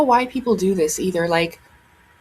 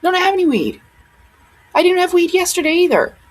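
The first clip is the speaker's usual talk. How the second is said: angry rant; 7 semitones higher than usual. Real, not acted. Ranting at rude commenters.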